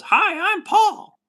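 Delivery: very high, loud squeaky alien hello; cartoon alien greeting. Streamer imitating the furry alien's intro, very high and loud. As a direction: wave and squeak like a cute three-eyed alien. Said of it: "he just waves and goes".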